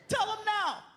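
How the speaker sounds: high, fast indignant woman; high hurried outrage; angry girlfriend voice. Retold or imitated. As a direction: rush it like a protective mama.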